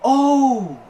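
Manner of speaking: "Oh" held long, high and loud; extended O. Retold or imitated. Shop guy mocking customer, "Oh" hugely drawn out in sarcasm. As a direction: stretch "Oh" right out on a high, falling swoop. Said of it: "and extended the O".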